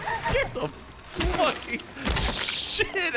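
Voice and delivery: high, slow words through laughter; laughing. Real, not acted. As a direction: still laughing from the scare. Laughing after jump scare.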